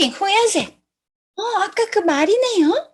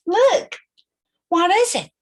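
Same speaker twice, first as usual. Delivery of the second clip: usual speaking voice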